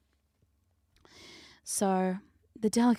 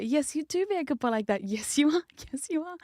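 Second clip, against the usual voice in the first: higher, singsong cooing; pet-talk praise; 7 semitones higher than usual. Pretend. Host teasing a chatter as good boy, high cooing. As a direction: coo it high, like praising a puppy.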